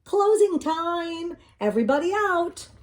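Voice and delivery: high, loud manager's call to the whole room. A narrator's reading. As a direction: call it across the laundromat. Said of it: "the manager of the laundromat called".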